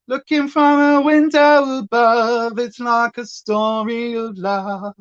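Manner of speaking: slow sung line in a light Essex London accent. Shown as a demonstration. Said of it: "sing it in a bit of an Essex London accent".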